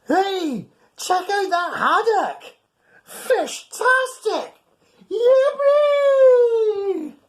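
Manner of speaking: high, loud, squealing cheer; gleeful cats. Acted. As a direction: whoop with delight over the fish. Cats cheer over the haddock.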